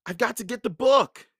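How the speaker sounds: high, loud insistence; calling out. A narrator's reading. Jack explains to Annie why he returns, calling. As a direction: call it out with urgent determination. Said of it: "Jack called".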